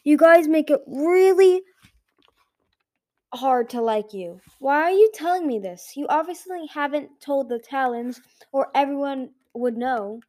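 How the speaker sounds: exasperated angry dragon. Acted. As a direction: snap at him in frustration. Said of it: "she growled".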